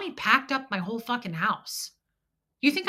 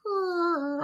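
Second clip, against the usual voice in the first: alarmed; 9 semitones higher than usual. Retold or imitated. Retold fearful reaction to the rant.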